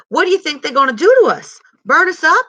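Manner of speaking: put-on a small boy bursting with alarmed excitement; excited. A narrator's reading. Little brother asking Stacy what the night men will do, excited and loud. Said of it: "said little man excitedly".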